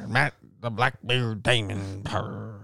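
slow pirate introduction with a growled arr; mock pirate. Host naming his pirate self, mock pirate voice. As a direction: draw it out like an old sailor. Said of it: "Talk like a sailor".

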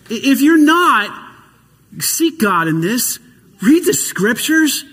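ordinary talk, nothing marked

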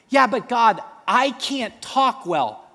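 high anxious plea; whiny Moses. Acted. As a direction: drag out the excuse, pleading. Moses arguing with God.

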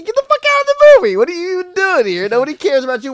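high, loud, fast yelling; mock yell at dad. Ranting at the movie dad, loud high yell. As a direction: tell the dad off at full blast.

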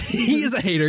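high, amused agreement; real giggle; laughing. Real, not acted. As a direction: echo it through a laugh.

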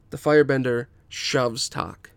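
usual speaking voice

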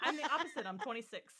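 self-mocking, through laughter; laughing. Real, not acted. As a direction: laugh as you admit it. Joking about own forgetfulness.

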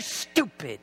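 "stupid" dropped low and soft, muttered. Shown as a demonstration. Preacher muttering "stupid" to himself, low and soft. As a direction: mutter "stupid" under your breath. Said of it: "repeated word, first take low".

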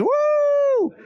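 high whooping cheer. Retold or imitated. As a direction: whoop like an excited crowd.

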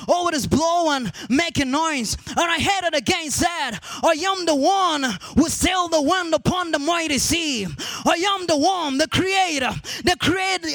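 high, driving sermon delivery; impassioned preacher rousing the church; full-force preaching. Real, not acted.

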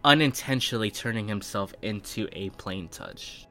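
ordinary talk

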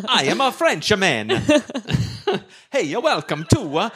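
high, laughing mock French accent. Owner welcomes Nancy to his bistro, mock French accent. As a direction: ham up a French host. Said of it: "an Italian-looking Frenchman".

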